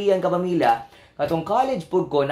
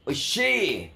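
exaggerated Japanese exclamation; mock Japanese accent; 3 semitones higher, much slower than usual. Host imitating Japanese speakers, exaggerated Japanese accent. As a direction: draw out the endings like mock Japanese. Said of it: "mga Japanese ka".

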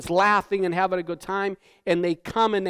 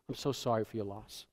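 softer, lower hushed sympathy; hushed condolence; 8 semitones lower, 12 dB quieter than usual. Retold or imitated. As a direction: murmur it like at a wake. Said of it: "and you say".

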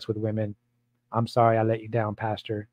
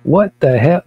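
loud disbelief; 4 semitones higher, 9 dB louder, slower than usual. Real, not acted. Repeated outrage, louder.

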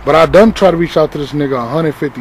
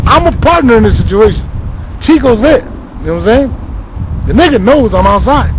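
indignant outburst; 7 semitones higher, 4 dB louder than usual. Real, not acted. Venting about being left outside.

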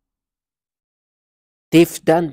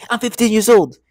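higher, louder retold outburst; mock sudden outburst; 7 semitones higher, 6 dB louder than usual. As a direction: imitate someone blurting it out loud and high. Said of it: "she just burst out and say".

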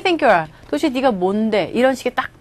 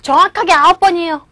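put-on higher, louder voice of a cheeky child; pointedly precise son; 5 semitones higher, 5 dB louder than usual. Son correcting mother on how many times, pointedly precise. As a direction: pipe up with an exact correction. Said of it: "꼭 집어서 말하는 아들".

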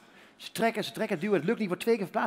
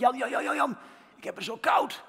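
calling out; 5 semitones higher than usual. Retold or imitated. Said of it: "zei ik".

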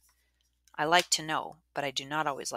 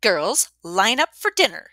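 loud, higher, slow command; stern demand; 5 semitones higher, 11 dB louder than usual. Acted. Missus Dwyer ordering the girls to dinner, loud and stern. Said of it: "she demanded".

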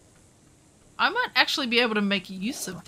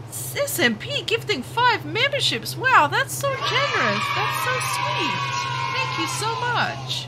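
high, gushing thanks after a gasp; delighted gratitude; 9 semitones higher than usual. Real, not acted. Streamer thanks a viewer gifting five memberships, delighted. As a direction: gush with real, high-pitched amazement, drawing out the praise.

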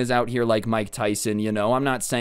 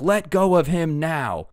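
slow, raised yell to let go; 6 semitones higher, slower than usual. Retold or imitated. Manager slowly yells at the customer to let go. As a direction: bark it out slowly, higher and firmer. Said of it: "he yells at the Karen".

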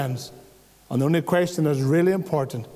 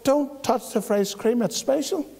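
mock pleading; 6 semitones higher than usual. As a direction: tease the wife's warning, trailing off. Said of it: "He would go".

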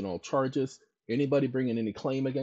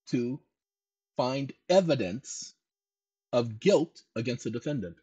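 dictating. Shown as a demonstration. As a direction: spell out every quote mark for a typist. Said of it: "dictating with punctuation spoken".

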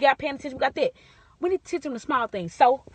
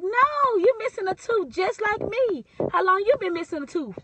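put-on a child pleased with his discovery; bright, excited kid's question; a small boy proudly pointing out a missing tooth; eager little boy, pitched higher; 6 semitones higher than usual.

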